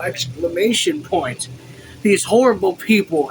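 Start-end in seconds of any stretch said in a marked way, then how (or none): 2.0-3.3 raised, louder emotional outburst. A narrator's reading. Officer despairing of the townspeople, emotional outburst. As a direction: exclaim it in distress. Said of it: "he spoke with emotion".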